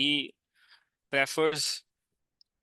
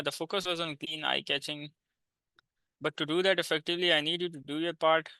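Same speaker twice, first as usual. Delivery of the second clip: slow reading aloud; much slower than usual. Shown as a demonstration. Reads proposal aloud.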